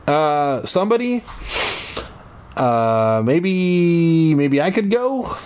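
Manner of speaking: high, hesitant, nervous offer; shaky voice. A narrator's reading. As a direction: stammer it out scared, rising high. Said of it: "offered in a shaky voice".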